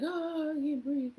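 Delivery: high, breathless mock panic; flighty panic. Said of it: "getting flighty".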